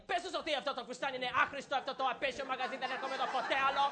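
soft, high, rapid-fire Greek grumbling; imitated Greek mom. Greek mom to her child at Macy's register, hushed rapid grousing. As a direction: rattle off the complaint under the breath. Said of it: "my mom is just like".

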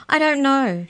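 high, loud despairing cry. A narrator's reading. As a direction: wail it out helplessly. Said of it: "she cried".

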